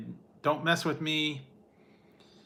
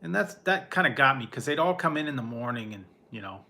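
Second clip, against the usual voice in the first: normal talking voice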